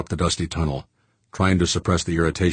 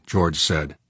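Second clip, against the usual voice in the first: ordinary delivery, nothing marked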